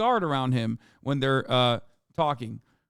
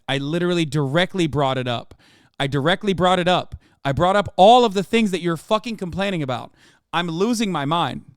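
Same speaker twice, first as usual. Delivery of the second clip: louder, rising frustration, nearly yelling; exasperated rant; 3 semitones higher, 6 dB louder than usual. Real, not acted. Streamer fed up with chat, venting loudly. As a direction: vent the irritation hard at chat.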